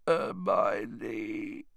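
soft, weak croak of a name. A narrator's reading. Ron in his sickbed calls to Hermione, croaking. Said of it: "croaked Ron unexpectedly".

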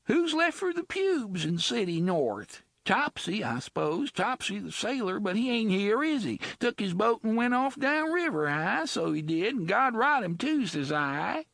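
high, coarse dialect woman's voice; rough slum woman. Acted. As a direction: sullen bloody woman voice. Bloody woman character voice.

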